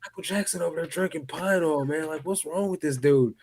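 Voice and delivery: can't believe what he's watching; telling a friend about the inmate drinking cleaner, in disbelief; retold disbelief. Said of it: "and I'm like".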